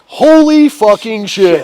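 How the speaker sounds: loud, high, drawn-out disbelief; awed amazement. Retold or imitated. TV channel seeing the chaotic footage, awed amazement. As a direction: stretch each word in mock astonishment.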